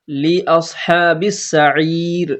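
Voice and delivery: very slow, drawn-out Arabic phrase; slow recitation. Shown as a demonstration. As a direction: stretch আসহাবিস সাঈর. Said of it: "ধাক্কা দিয়ে পড়তে হবে". (লি আসহাবিস সাঈর টেনে পড়া)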